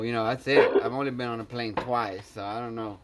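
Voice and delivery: normal talking voice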